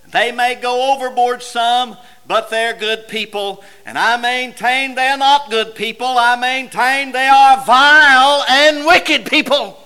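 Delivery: full-force pulpit climax; impassioned preaching. Real, not acted.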